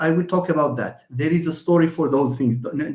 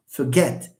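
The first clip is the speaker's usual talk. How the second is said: "Forget" stressed on GET; weak fer, strong GET in "Forget"; careful fer-GET model of "Forget"; stress on second syllable; 3 semitones lower than usual. Shown as a demonstration. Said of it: "Get is the one that is stressed".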